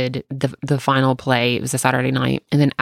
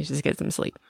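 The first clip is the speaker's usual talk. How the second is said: whisper; 9 dB quieter than usual. Retold or imitated. Soothing kid at night.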